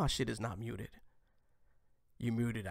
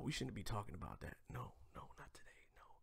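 barely audible whispered aside; hushed self-correction; 4 semitones lower, 14 dB quieter, faster than usual. Real, not acted. Host dropping the Corona joke, hushed aside. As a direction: whisper it to yourself, backing off.

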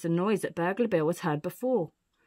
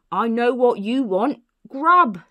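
loud, slow announcement, punchy last word; hearty storybook burglar; Burglar Bill; 4 semitones higher, 9 dB louder, slower than usual. Acted. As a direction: announce it slowly, then burst out the final word. Burglar announces his solution.

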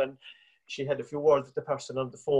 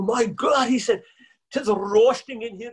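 exasperated exclaim; 8 semitones higher, 5 dB louder, slower than usual. Retold or imitated. Danny overheated after the call.

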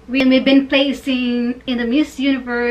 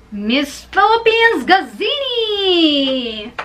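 high, drawn-out cheering reveal; excited announcement; 5 semitones higher, 3 dB louder, slower than usual. Real, not acted. Biased fan names Miss Philippines, cheering announcement. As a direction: announce the name slowly with a proud cheer.

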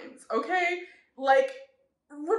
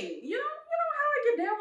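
teasing; 14 semitones higher than usual. Real, not acted. Teasing high voice.

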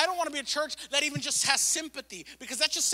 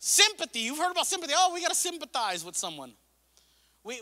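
normal talking voice

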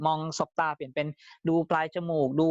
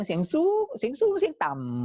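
high tone to low; 10 semitones higher than usual. Shown as a demonstration. Trainer showing sales trainees vocal tone, high then low. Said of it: "โทนเสียง เสียงสูง เสียงสูง เสียงต่ำ".

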